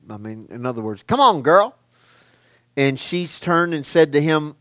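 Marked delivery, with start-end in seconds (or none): 1.0-1.8 raised, louder mock-exasperated call; playful exhortation. Preacher paraphrasing Jesus to Mary, playful exhortation. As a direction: rouse her with a high, loud nudge.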